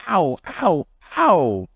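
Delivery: high yelping ow ow ow; pained ow cries. Acted. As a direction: yelp in cartoon pain. Stomach ache from banana.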